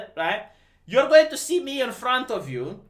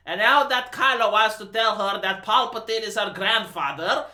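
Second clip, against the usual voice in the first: usual speaking voice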